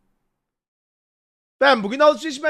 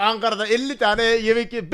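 ordinary talk, nothing marked

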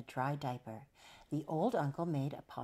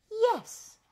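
very high, loud yes; delighted mummy; 9 dB louder than usual. A narrator's reading. Mummy agreeing to take the baby, high loud cry. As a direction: exclaim it high, overjoyed. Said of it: "the mummy said".

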